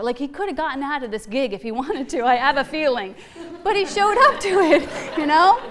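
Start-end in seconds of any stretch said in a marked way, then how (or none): 3.6-5.6 laughing through the words, brighter and louder. Real, not acted. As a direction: let a laugh carry the line. Laughing while preaching.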